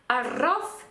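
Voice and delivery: «арроф» modelled as a-RROF with rolled r; final-syllable stress. Shown as a demonstration. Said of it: "останній склад".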